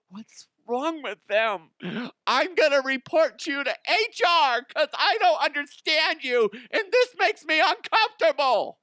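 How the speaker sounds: very high mocking squeal; hammed-up shrieky office complainer; whiny offended coworker. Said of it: "people are gonna go".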